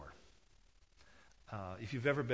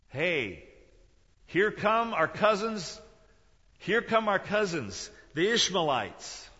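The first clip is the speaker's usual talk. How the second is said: calling out; 8 semitones higher, 10 dB louder, slower than usual. Acted. Brothers hail the approaching caravan.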